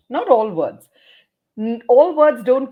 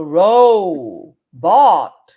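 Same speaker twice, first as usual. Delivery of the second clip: drawn out syllable by syllable, louder; robot syllables; 4 semitones lower, 9 dB louder than usual. Shown as a demonstration. Teacher showing how to find syllables, robot-like. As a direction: stretch "row" and "bought" apart like a robot. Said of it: "speak like a robot".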